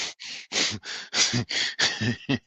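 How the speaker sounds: long, voiceless wheezing laugh; helpless airy laughing fit; incredulous laughter. Real, not acted. Laughs at the ticket threat.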